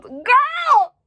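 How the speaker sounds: high squealing cry of disbelief; shocked shriek. Real, not acted. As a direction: shriek it out, scandalised. Shocked by cringy review.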